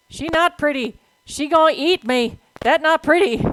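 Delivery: loud, high-pitched play-indignation; mock indignant protest. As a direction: protest loudly in broken baby grammar. Mock argument about the spider boss.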